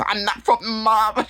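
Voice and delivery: high, drawn-out mock denial; hammed-up indignant denial; mock protest. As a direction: protest it high and slow, imitating her. Acted indignant denial from the show.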